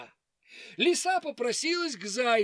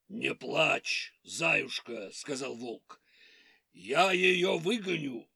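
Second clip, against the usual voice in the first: wolf voice; 6 semitones lower than usual. Acted. Wolf comforts hare.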